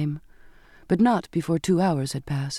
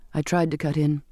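ordinary delivery, nothing marked

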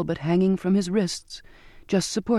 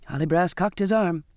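usual speaking voice

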